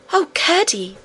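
high, bright joyful call; joyful cry. A narrator's reading. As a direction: call out in delight. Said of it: "cried Irene, clapping her hands right joyfully".

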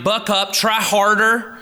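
high, loud mock pep talk; imagined stern voice. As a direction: push hard like an imagined taskmaster, drawing it out. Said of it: "I hear his voice in those moments".

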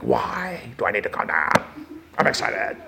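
hushed, low protest of excitement; barely voiced, comic excitement; mock-subdued retort. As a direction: protest under your breath. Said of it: "I'm like".

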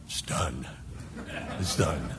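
low, soft sigh of completion; hushed, weary relief. Acted. Parent done wrapping presents at four a.m., hushed relief. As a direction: drop low and soft, job finally over.

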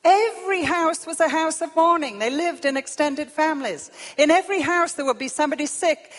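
loud, high, driving declamation; preaching at full force. Real, not acted. Preacher describing houses of mourning in Solomon's time, forceful preaching. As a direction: drive each phrase out loudly, pitched high.

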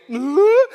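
high, loud rising "ooh"; mock delighted girlish ooh; imitated squeal of delight; excited squeal. Acting out her excitement.

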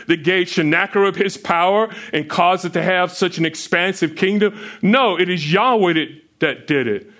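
rising pulpit fervour, pressing each question; impassioned sermon build; preaching at full force. Acted. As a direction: drive it home like a revival preacher. Rising preaching on false gods.

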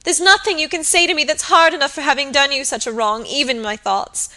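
high, agitated, imploring voice; distraught apology. A narrator's reading. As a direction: plead through distress. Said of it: "sobbed Phoebe".

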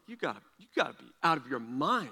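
low, soft, quick disbelief; incredulous. Retold or imitated. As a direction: mutter that nobody could believe this. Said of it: "I'm like".

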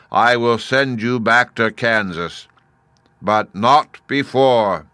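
imperious, measured boom; commanding Great Head. A narrator's reading. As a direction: lay down terms like a booming giant.

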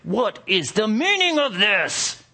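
high, furious shout of a blacksmith; angriest voice. Acted. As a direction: roar it out, outraged. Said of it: "in the angriest voice they had ever heard".

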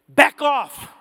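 loud command. Acted. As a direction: hurl the warning at the congregation. Warning repeated with force.

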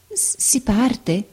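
slow, soft, stammering fear; frightened stammer. Acted. Frightened girl stammers back at the sick woman, softly. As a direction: stumble over it, afraid to understand. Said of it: "balbettò la barberina che aveva paura".

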